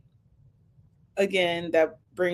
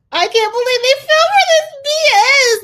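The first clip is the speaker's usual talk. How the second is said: high, loud mock outrage; outraged mock quote; 10 dB louder than usual. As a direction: blurt it out like an offended viewer, high and loud. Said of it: "he's like".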